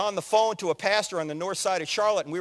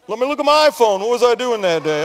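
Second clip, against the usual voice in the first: louder, bemused voice checking a phone; mock puzzled audience; 3 semitones higher, 9 dB louder than usual. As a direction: play the forgetful listener scrolling for answers.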